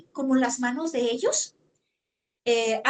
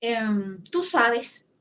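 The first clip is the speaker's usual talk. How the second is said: ordinary delivery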